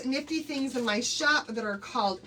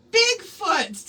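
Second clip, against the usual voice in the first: the keyword again, louder and higher; emphatic repeat; 7 semitones higher, 8 dB louder than usual. Shown as a demonstration. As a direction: call out Bigfoot louder and higher the second time. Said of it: "the keyword for tonight is".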